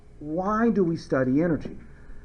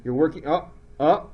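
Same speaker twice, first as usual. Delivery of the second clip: normal talking voice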